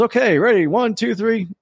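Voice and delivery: high-pitched starting count; host's countdown. Retold or imitated. Bar host starting the drinking race, high-pitched countdown. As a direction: imitate a host kicking off the contest. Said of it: "finally he goes".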